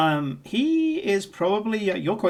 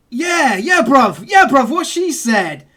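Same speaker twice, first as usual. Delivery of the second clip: higher, louder cockney geezer chatter; dock worker's London accent; 7 semitones higher, 4 dB louder than usual. Acted. Dock worker sizing up the player, loud high cockney bravado. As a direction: play a cheeky London chancer. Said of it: "looks at you and goes".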